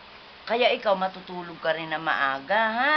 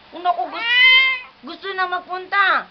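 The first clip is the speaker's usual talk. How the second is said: put-on high, loud, eager little-kid voice; speaking for the grandchild who wants to go to school, high and eager; voicing the child; 10 semitones higher, 8 dB louder than usual. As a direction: pipe up like the toddler begging to go.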